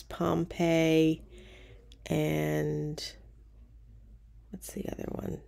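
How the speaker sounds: ordinary delivery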